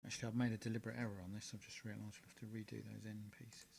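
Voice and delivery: low mumble to himself; mumbling. Real, not acted. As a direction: mutter it under your breath, half to yourself. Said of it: "Don't mumble to yourself".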